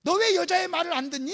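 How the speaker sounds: staged reproach; acted rebuke. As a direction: scold them as you imagine a stern master would. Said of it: "이렇게 책망했을 거예요".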